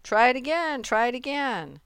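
high, urging goose; high-pitched cheering; excited cry. A narrator's reading. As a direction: egg a friend on eagerly. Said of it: "cried the goose".

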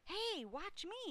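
high, drawn-out show-off yell; Avery's yell. A narrator's reading. Boy showing off by the crate, high yell. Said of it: "yelled Avery".

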